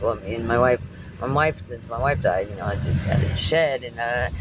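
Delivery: low imitation of a rambling mumbler; mumbling old man. Imitating the old customer talking about his late wife, mumbling. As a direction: mumble along, pitched well down. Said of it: "trying to sound like him when he was mumbling".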